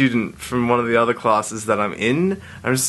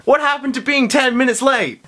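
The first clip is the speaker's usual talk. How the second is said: much higher, pointed complaint; calling out the friend who joined the prank; mock indignant; 12 semitones higher than usual. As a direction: tease him for showing up late, pitch up. Confronting a late friend.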